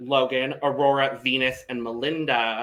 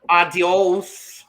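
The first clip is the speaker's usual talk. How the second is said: put-on Canadian accent; 5 semitones higher, 7 dB louder than usual. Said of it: "I just tried to say adios in a Canadian".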